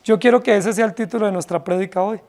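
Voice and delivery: ordinary talk, nothing marked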